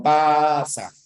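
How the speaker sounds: "Pasa" with a held "paa", then "sa"; syllable boundary shown. Shown as a demonstration. Teacher repeating the example of the boundary, split syllables. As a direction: draw out the first syllable of "Pasa". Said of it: "termina en vocal".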